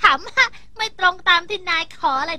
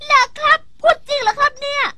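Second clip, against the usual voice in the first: thrilled disbelief; 8 semitones higher, 5 dB louder than usual. Acted. Thrilled at the offered reward.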